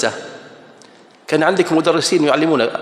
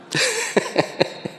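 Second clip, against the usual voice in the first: high laugh; 7 dB quieter than usual. Real, not acted.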